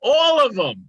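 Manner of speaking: loud, high shouted answer; yelling back. Real, not acted. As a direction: yell it straight back. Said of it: "You don't have to yell back".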